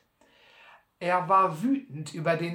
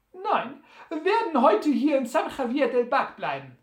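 high, loud, brisk character voice; high-voiced Sam; 7 semitones higher, 5 dB louder than usual. Sam tells Butler of his plans, high and brisk. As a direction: answer readily in a high, carrying voice.

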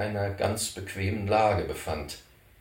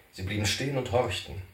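ordinary delivery, nothing marked

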